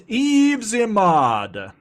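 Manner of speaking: announcer voice. Acted. Announcer voice, slowed.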